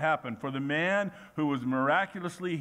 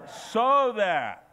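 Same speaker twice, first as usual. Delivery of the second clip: "So that" said higher and louder; a louder, higher call of "So that"; emphatic repeat; 6 semitones higher, 5 dB louder than usual. Shown as a demonstration. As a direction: punch "So that" for the crowd. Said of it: "everybody say".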